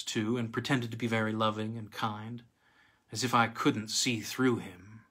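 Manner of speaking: ordinary delivery, nothing marked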